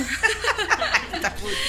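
high, bright burst of laughter. Acted. Doctor laughing at Remedios' joke about her donkey.

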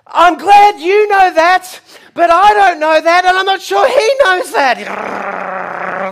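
slow, loud shout from afar; calling back. Retold or imitated. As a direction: yell back across the field, drawn out. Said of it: "And I call back".

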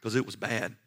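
ordinary delivery

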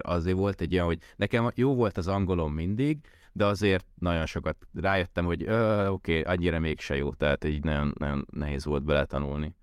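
ordinary delivery

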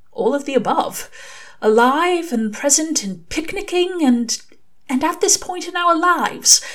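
a fussy angel pouring his heart out; Aziraphale, earnest. Acted.

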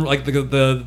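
ordinary delivery

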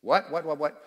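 rapid repeated "what" like quacks; short duck imitation on one word. Shown as a demonstration. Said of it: "That's a duck".

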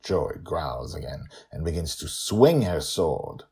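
usual speaking voice

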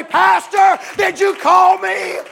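high, loud, slow eager cry; eager shout. Acted. Preacher to youth, acting a youngster woken and shouting high. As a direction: jump up and shout it to the pastor.

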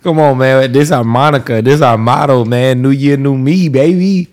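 loud, sassy hype. Pretend. Co-host announcing the show's motto for new year, sassy. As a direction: belt out the motto with playful sass.

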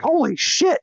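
stunned gasp, high; a sudden amazed "holy shit"; surprised. Retold or imitated. Reacting to a surprise card.

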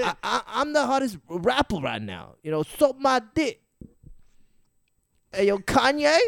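high, loud rapper impression; six nine. Bragging as a rapper, high and loud. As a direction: boast in a high, raspy New York voice. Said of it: "he's six nine".

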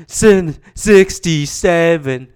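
a fan calling out a player from the stands; fan yelling. Retold or imitated. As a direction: bellow the number across the arena. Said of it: "the one guy was yelling".

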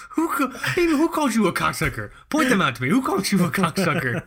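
loud, high, indignant; mock outraged yell. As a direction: demand to know who said it. Mock outrage defending friend.

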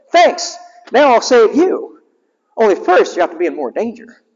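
high sly cartoon hero; high smug hedgehog hero; Sonic's voice. Sonic tricks robots.

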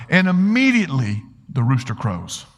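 ordinary talk

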